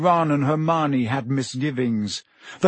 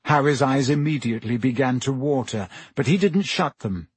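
ordinary delivery

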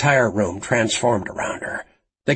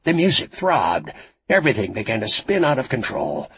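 plain reading voice

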